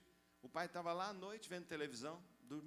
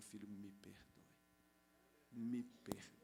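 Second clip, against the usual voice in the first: tender apology; 5 semitones lower, slower than usual. Retold or imitated.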